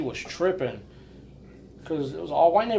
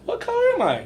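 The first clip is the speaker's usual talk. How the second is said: very high, incredulous question; outraged disbelief. Retold or imitated. Tow driver asking the customer, squeaky and incredulous. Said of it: "I was like".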